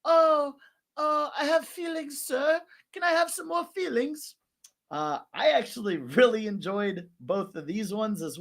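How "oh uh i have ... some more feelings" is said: high, pleading British caricature; mock British accent. Host imitating Mimic asking for feelings, hammed-up British accent. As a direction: put on a piping British voice, politely begging. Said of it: "And then Mimic being like".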